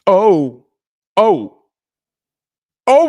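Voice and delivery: normal talking voice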